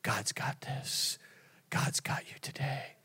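near-whispered retold reassurance; gentle commending. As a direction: imitate a friend softly handing it over to God. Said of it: "commending me".

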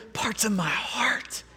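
a hushed, breathy question; whispered. Acted. Preacher voices God confiding his heart to Jonah, whispered. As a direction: let it fall to a whisper, tender. God's tender rhetorical question.

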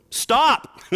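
high, loud sudden command; commanding shout. Pretend. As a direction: bark it out like an order. Preacher acting out the command.

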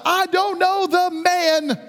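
very high, drawn-out outcry; high-pitched, slow, emphatic protest; exclaimed denial. Acted. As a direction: act out a big public denial, stretching each word. Peter's outburst making a big scene.

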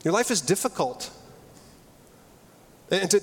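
ordinary talk

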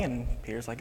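ordinary delivery

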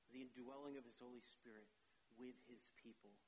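ordinary talk

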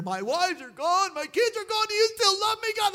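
high, loud pleading complaint; desperate loud lament; anguished cry. Acted. David's anguished quoted complaint.

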